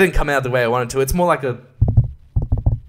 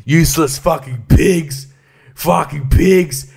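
louder, slow disgusted sneer; mock contempt; 3 semitones higher, 4 dB louder, slower than usual. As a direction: spit it at the cops. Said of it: "And I'm like".

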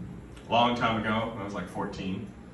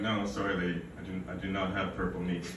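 meek apology; 7 semitones lower, 8 dB quieter, faster than usual. Retold or imitated. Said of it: "And I'm like".

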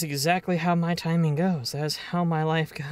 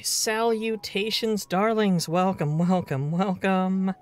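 grand theatrical greeting; 5 semitones higher, slower than usual. Pretend. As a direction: stretch "salutations" like a stage host. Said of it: "I said darlings".

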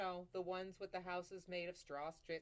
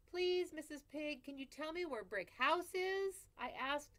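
higher, louder sweet-talking wolf; polite wolf; 9 semitones higher, 8 dB louder than usual. Acted. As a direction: coax the pig with overdone politeness. Wolf asks at the door.

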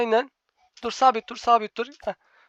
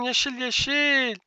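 slow, stretched-out repeat; exasperated drawn out; much slower than usual. Real, not acted. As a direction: spell it out to a teammate who won't get it. Teammate won't press green.

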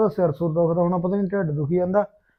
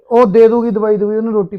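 much louder, emphatic assurance; loud insistent reassurance to a friend; loud insistence; 2 semitones higher, 11 dB louder than usual. Acted. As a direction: push it out loud to settle the matter. Brother insisting loudly.

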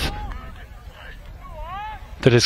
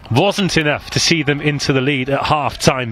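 ordinary delivery

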